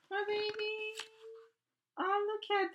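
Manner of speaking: high, gasping coo; high excited coo; gasp then coo. Real, not acted. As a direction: gasp and coo over the photo. Fangirling over photo.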